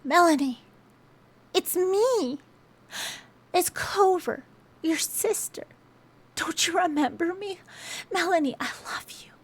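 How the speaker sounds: gently begging her to remember; pleading with sister. Retold or imitated. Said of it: "she's like".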